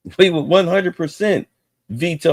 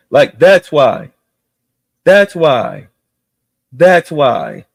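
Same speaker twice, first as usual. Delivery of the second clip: loud insistence; 6 dB louder, slower than usual. Real, not acted. Ranting, repeating point loudly.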